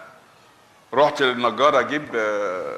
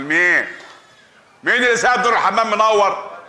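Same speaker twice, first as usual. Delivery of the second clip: higher and louder demanding question; angry scolding; 7 semitones higher, 4 dB louder than usual. Acted. Husband scolding wife about the bathroom light, loud. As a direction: demand who left the light on.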